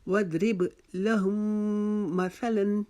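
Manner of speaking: slow recitation, hold the m from लहुम into मसलन; measured chant with a long nasal m in लहुम मसलन; held nasal ghunna. Shown as a demonstration. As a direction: hum the joined m between लहुम and मसलन. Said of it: "एक अलिफ की मिकदार रुकेंगे".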